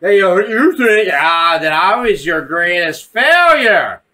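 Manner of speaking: slow, loud, garbled villain imitation; mock glitching, laboured villain voice; broken voice box. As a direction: cut in and out like a damaged mask. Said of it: "the voice is cutting in and out".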